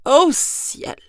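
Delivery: high, loud gasp of fright; frightened snake exclaiming; alarmed exclamation. Acted. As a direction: cry out in sudden dread. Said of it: "s'exclama-t-il".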